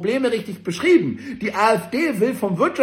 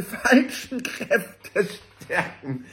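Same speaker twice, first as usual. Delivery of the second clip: laughter bursting through the words; cracking up; 4 dB quieter, much slower than usual. Real, not acted. Mocking the paper's plea to Stihl, cracking up. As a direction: try to finish the quote while laughing. Mocked quote collapses into laughter.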